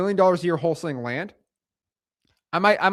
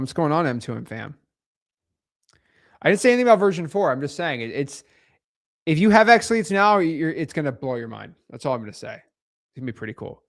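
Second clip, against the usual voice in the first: usual speaking voice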